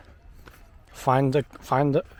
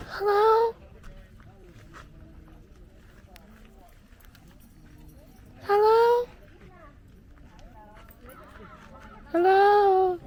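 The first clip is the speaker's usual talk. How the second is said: sing-song calling; 13 semitones higher, slower than usual. Acted. Calling to wake sleeper.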